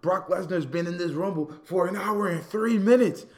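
mock commentator's loud, high amazement; astonished exclamation. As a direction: build to a stretched, high cry of disbelief. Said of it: "they could be like".

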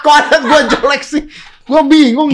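amused indignation; laughing protest. Real, not acted. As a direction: protest while cracking up.

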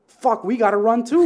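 panicked. Retold or imitated. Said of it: "and I'm like".

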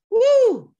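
loud, high-pitched whoop; big "woo". Real, not acted. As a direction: let out a long, high "woo". Exclaims at fear of success.

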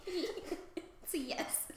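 very slow, unvoiced whisper; the seal giving a slow whispered yes. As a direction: breathe out the answer slowly and hushed. Voicing the seal.

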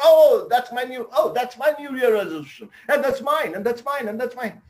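high, eager voices finding their names; bubbly excitement at the notice board; excited teachers. Retold or imitated. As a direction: teachers thrilled to spot their own resolutions.

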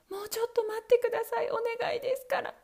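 high, quick begging; wife pleading with Bluebeard for time. Acted. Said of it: "と奥さんは言いました".

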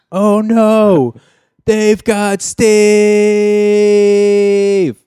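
high, loud, drawn-out dismay; Carl Winslow impression. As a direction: wail it like a panicked sitcom dad. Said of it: "That was me".

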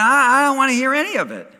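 high, loud, rushed mock protest; hammed-up fed-up refusal; retold exasperated outburst. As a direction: cut them off in mock irritation. Re-enacts himself refusing to listen.

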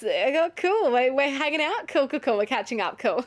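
imitating a jealous guy acting casual; mock forced casual. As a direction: pretend not to care, too brightly. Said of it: "he was kind of like".

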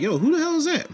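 high, startled friend asking; baffled guest pointing at the painting; confused visitor. Retold or imitated. Visitors reacting to strange portrait.

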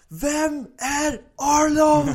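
high, loud, long-drawn challenge; stretched-out battle yell; imitated hero's roar. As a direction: bellow it slowly like an anime hero. Hero's challenge acted after door smash.

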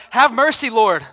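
loud, desperate begging for mercy; a desperate plea to be spared; pleading. Retold or imitated. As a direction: beg God not to kill him, loud and high. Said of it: "He's like".

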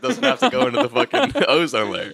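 high laughing voice. Real, not acted. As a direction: crack up on the last words. Laughing joke.

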